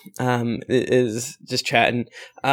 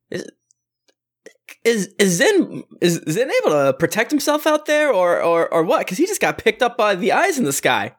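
higher, anxious voice of a sister asking about a friend; worried sister; 7 semitones higher than usual. Acted. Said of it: "she looks at all of you".

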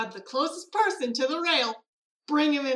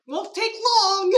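slow, higher, louder threat; menacing character voice; 8 semitones higher, 8 dB louder than usual. Acted. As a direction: tease the victim slowly. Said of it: "she's just like".

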